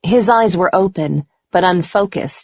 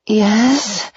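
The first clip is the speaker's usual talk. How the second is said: higher, moaned yes; shameless moan; 6 semitones higher than usual. A narrator's reading. Woman in her lover's arms, moaning shamelessly. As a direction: moan it with open pleasure. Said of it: "I moaned shamelessly".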